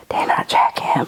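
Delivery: hushed, breathless aside, almost no voice; retold whisper. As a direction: lean in and whisper the warning. Someone warns her in the shop.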